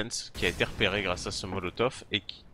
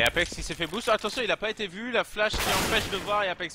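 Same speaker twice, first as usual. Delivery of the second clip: excited play-by-play; 8 semitones higher than usual. Real, not acted. Caster calls a surprise kill.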